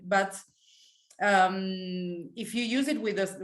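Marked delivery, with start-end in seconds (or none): none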